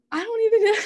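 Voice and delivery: high, choked-up nervous laugh on the words; emotional. Real, not acted. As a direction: get emotional and laugh it off, voice shooting up. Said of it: "Sorry, I don't get emotional".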